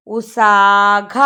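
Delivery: ഉസാഘ very slowly, syllable by syllable; slow model reading of ഉസാഘ; reading demonstration. Shown as a demonstration. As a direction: hold each long vowel in ഉസാഘ. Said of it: "ഇപ്പൊ എന്താ വായിക്കുക?".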